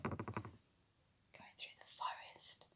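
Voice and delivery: slow hushed whisper. A narrator's reading. Storyteller to children, a slow whisper. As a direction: creep through the words.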